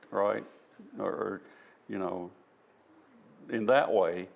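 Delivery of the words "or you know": ordinary delivery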